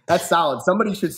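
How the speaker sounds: high, laughing, still amused; words bubbling with real laughter; laughing while talking. Real, not acted. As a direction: talk through the laugh. Laughing at the DVD name joke.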